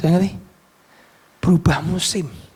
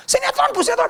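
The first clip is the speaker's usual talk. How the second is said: pupil's excuse; faster than usual. Retold or imitated. Pupils answer the teacher.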